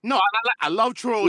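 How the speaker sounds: high, loud burst of enthusiasm; eager and loud about it; excited. Real, not acted. Excited outburst about trolls.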